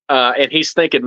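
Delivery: ordinary talk, nothing marked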